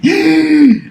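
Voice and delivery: fuerte jadeo agudo de asombro; jadeo de sorpresa. Acted. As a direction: aspira sorprendida, alto. Mamá sorprendida. (jadeo fuerte de sorpresa de la mamá)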